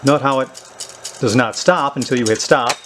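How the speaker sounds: usual speaking voice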